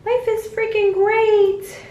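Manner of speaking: high, slow, gushing mock cheer; mock elated. Telling viewers about mood swings, hammed-up high happy voice. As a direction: squeal it like life is perfect. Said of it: "next day I'm like".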